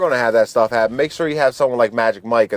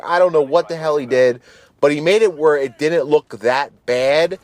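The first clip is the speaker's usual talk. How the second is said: ordinary delivery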